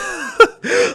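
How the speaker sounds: squealing burst of laughter; high laugh. Real, not acted. Laughing after hype quote.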